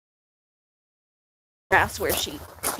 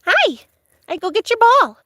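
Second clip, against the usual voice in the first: pet talk; 10 semitones higher, 6 dB louder than usual. Real, not acted. Urging the dog to fetch her ball, very high loud pet talk.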